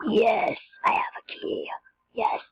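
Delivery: whispered glee over the key; excited whisper. Real, not acted. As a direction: hush it out, thrilled.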